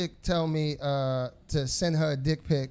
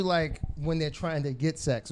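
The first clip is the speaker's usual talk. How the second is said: normal talking voice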